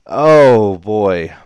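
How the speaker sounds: loud, fast relieved outburst; gasp, then rush out the relief; real excitement; loud exclamation. Real, not acted. Commentator reacts to a near bust.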